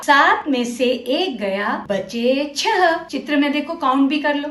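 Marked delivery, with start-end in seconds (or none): 0.0-3.0 सात में से एक गया said slowly, word by word; slow model sentence. Shown as a demonstration. Teacher slowly models सात में से एक गया for children to repeat. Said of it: "अब यह वाक्य दोहराओ".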